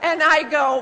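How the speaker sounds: normal talking voice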